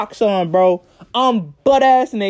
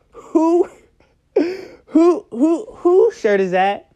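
mock stammering mom, higher voice; 6 semitones higher, much slower than usual. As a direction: imitate the mom tripping over her words. Mom stammering, demanding whose shirt.

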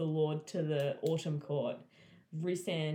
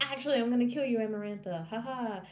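mock threat; 7 semitones higher, 4 dB louder than usual. Tamlin's mock triumphant threat.

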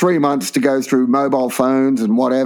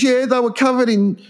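much higher, lightly mocking voice; new inmate; 10 semitones higher than usual. New inmate telling the biker why, much higher and mock-innocent. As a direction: pipe it up high, matter-of-fact.

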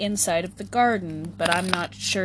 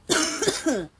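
one last rough cough; leftover cough; 10 semitones higher, 4 dB louder than usual. Real, not acted. Still recovering from the smoke, a rough cough. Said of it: "that really fucked me up".

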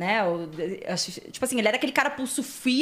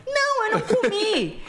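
high, slow reply; 10 semitones higher than usual. Retold or imitated. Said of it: "aí a pessoa".

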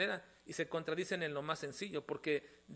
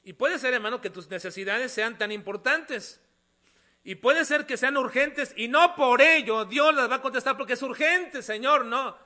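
loud, raised pulpit voice; forceful preaching; 7 semitones higher, 14 dB louder than usual. Real, not acted. Preacher warning a brother that urgency is not enough, forcefully. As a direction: press the point hard, leaning on key words.

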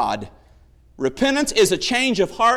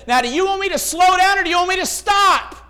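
angry policeman; 8 semitones higher than usual. Retold or imitated. Policeman shouting at the driver he beats. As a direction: bark the question like a furious cop.

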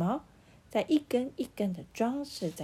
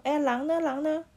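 higher, louder puzzled calls; puzzled asking; 7 semitones higher, 5 dB louder than usual. A narrator's reading. Villagers searching for the wolf, puzzled calls. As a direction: look around and ask, baffled. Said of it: "大家疑惑的边找边问说".

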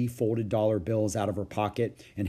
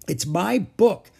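loud, high, drawn-out protest; insistent child; 9 semitones higher, 8 dB louder, slower than usual. Acted. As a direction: stake your claim, stretching the words. Child insists on ownership of book.

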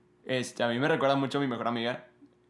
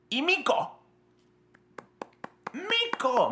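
high, gleeful calling of the name; a high, delighted cry of a favorite name; excited; much slower than usual. Real, not acted.

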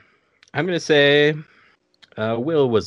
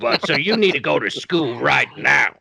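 movie ghoul, mouth full of ice cream; emphatic ghoul impression round a mouthful; Beetlejuice; 3 semitones higher than usual. Improv player sending Harry to school, mouthful ghoul impression. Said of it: "do Beetlejuice while eating ice cream".

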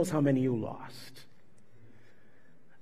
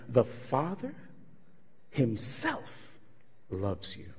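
tender quote; 3 semitones lower, 8 dB quieter, much slower than usual. Retold or imitated. Quoting Jesus tenderly.

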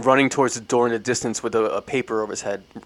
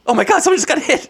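high, fast panicked cry; 5 dB louder, faster than usual. Retold or imitated. Robert telling the guests someone was hit, high and panicked. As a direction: blurt it out in panic. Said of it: "He goes".

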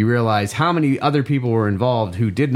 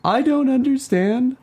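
terrible mom voice; 11 semitones higher than usual. Retold or imitated. Son imitating his mother's worried text in a high voice. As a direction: squeak it out like a fretting mom. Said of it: "so I can do this terrible voice".

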